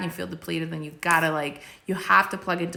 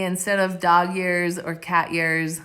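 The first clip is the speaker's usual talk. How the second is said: ordinary talk, nothing marked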